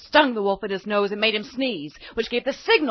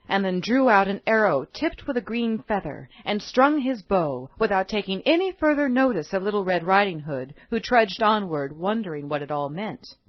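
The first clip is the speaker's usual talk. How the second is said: ordinary delivery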